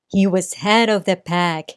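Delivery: ordinary delivery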